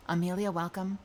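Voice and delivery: ordinary delivery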